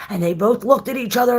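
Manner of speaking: usual speaking voice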